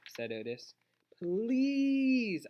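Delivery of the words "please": long, high, loud drawn-out plea; begging. A narrator's reading. Girl begs a reluctant man to come, pleading. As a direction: stretch the word out and plead. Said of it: "I begged".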